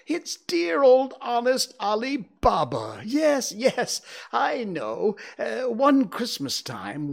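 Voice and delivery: put-on high, loud, gushing old Scrooge; ecstatic. A narrator's reading. Scrooge reliving his lonely boyhood reading, in ecstasy. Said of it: "Scrooge exclaimed in ecstasy".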